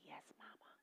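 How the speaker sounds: hushed whispered reply; whispered yes. Real, not acted. Daughter answers her dying mama, whispering.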